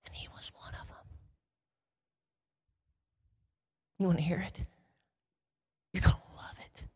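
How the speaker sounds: hushed secretive whisper. Real, not acted. Preacher letting the audience in on a secret, whispered. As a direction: draw them in with a whisper.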